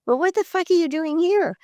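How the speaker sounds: high, incredulous other voice; stranger's blunt question. Retold or imitated. A stranger in the restaurant asks the waitress why she works there, high and incredulous. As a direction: play the stranger, stunned to see a film actor waiting tables. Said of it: "He goes".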